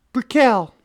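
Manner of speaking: high, loud, bright-pitched exclamation; loud high-pitched introduction; calling out a name. Acted. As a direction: sing out your name in a woman's high voice. Woman calls out her name.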